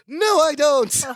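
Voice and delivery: high, gleeful acted cry; just as excited. Balky matching Larry's excitement without understanding, excited. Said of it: "And Balky, just as excited".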